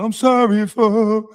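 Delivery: high, slow pitiful imitation; mocking stutterer. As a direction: whimper it like a scared man.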